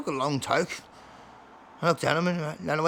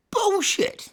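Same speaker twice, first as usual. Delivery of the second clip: incredulous. Retold or imitated. Listener scoffs at the story.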